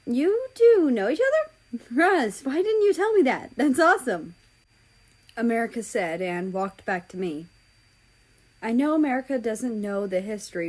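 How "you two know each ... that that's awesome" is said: high, loud and quick with delight; gush, thrilled by the news; excited. Acted. America, delighted, asks Russia why he never said, high and fast.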